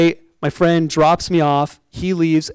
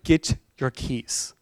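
slow, softer, lower dismissive mother; curt mom; 5 semitones lower, 7 dB quieter, slower than usual. Retold or imitated. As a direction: send him off coldly, slowly. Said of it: "not the most pleasant woman".

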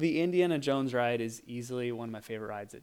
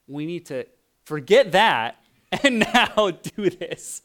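awkward laughing; 3 semitones higher, 8 dB louder, slower than usual. Retold or imitated. Dreaded conversation, mock awkward.

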